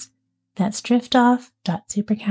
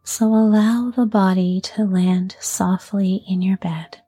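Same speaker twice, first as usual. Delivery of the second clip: unhurried yoga nidra voice, letting each phrase settle; guided sleep meditation. Acted. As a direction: ease a listener toward sleep, phrase by phrase. Leads guided sleep relaxation.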